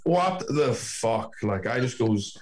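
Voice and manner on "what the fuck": slow, higher-pitched mock disbelief; incredulous retelling. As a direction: stretch it out as retold astonishment. Repeating his shocked reply.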